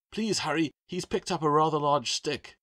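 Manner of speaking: high, loud frightened plea; panicked. A narrator's reading. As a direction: beg them to hurry in a panic. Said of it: "a definite sense of panic".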